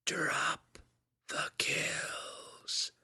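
far-off whisper, drop then kills; distant mind voice crying out; whispery telepathic voice. A narrator's reading. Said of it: "the telepathic voice cried again".

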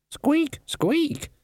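tiny high-pitched squeak; high rat squeak. A narrator's reading.

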